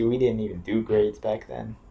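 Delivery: normal talking voice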